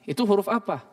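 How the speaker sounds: ordinary talk, nothing marked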